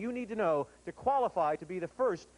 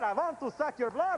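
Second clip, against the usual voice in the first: lightly mimicked vampire count, a bit higher; Dracula; 4 semitones higher than usual. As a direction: echo a contestant's hammy vampire line. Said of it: "give me your best Dracula impression".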